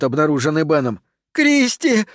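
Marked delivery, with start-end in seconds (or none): 1.3-2.1 very high, desperate call; screaming a name. A narrator's reading. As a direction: cry after someone abandoning you. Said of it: "кричит Кармен". (пронзительный отчаянный оклик)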